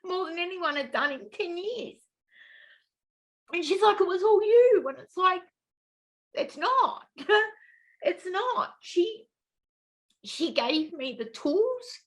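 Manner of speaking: high, softened voice close to crying; real tears rising as you give credit; tearful, emotional. Real, not acted. Client crediting her case manager with changing her life, tearful. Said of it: "I'm getting a little emotional".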